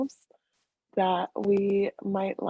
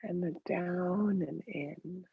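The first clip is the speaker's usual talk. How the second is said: guided meditation; 4 dB quieter than usual. Real, not acted. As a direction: lead them gently into the breath, unhurried.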